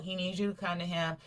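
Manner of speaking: usual speaking voice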